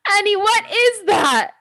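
high, slow shocked squeal; shocked shriek. Real, not acted. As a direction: shriek it in disbelief. Shocked at huge water bottle.

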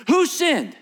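high, loud demanding question; disciples' question. Acted. Disciples demanding of Jesus who sinned, loud and high. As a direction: fire it at Jesus.

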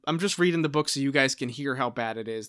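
normal talking voice

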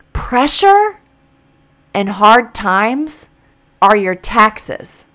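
"are your taxes" drawn out word by word; slow, weighty, every word spaced; slow emphasis. Real, not acted. Restating the quote slowly.

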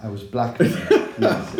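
loud, amused confession breaking into laughter; real laughter bursting through the words; laughing admission. Real, not acted. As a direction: laugh while owning up to it. Laughing along at host's joke.

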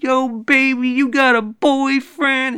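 high, loud, slow catcall voice. Retold or imitated. Guy catcalling a lady in the stairwell, high and slow. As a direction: slide in with a slow loud pickup line. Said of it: "I was like".

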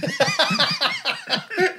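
bursts out in a high, hearty laugh; real laugh at his own anecdote; laughing at the punchline of his own story. Real, not acted.

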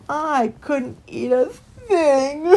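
high, loud tearful wail; wailing into sobs; weeping. A narrator's reading. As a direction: break into noisy acted sobs. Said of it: "wept Christopher".

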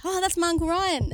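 put-on bright, thrilled little girl voice; excited kid. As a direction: squeal it like a proud kid. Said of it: "And I was like".

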